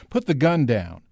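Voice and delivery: ordinary delivery, nothing marked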